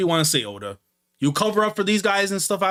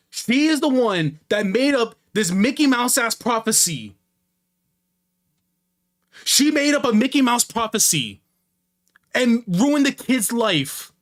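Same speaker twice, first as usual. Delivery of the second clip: higher-pitched, indignant outburst; angry rant; 7 semitones higher than usual. Real, not acted. Streamer outraged at mother's made-up prophecy, angry rant. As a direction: hammer it out in outraged disbelief. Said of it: "I'm angry".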